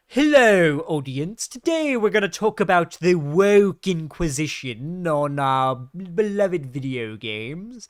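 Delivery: pompous fake British accent, loud on the greeting then slowing right down; fake British Arch. Mocking a YouTuber to his viewers in a fake British accent. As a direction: lay on a put-on posh British accent to sound clever. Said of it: "a fake British accent to sound smart".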